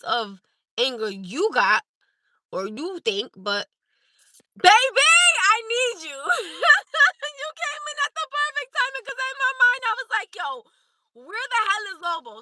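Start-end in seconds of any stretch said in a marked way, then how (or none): 4.6-12.4 much higher, delighted, half laughing; excited greeting. Real, not acted. Streamer greeting Lobo as he joins, high and delighted. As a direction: gush over a friend who just arrived.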